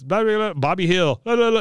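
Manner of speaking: high-pitched mock Laotian babble. Mother talking on about Bobby, high mock Laotian. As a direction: imitate Laotian with nonsense syllables. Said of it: "keeps talking in, in Laotian".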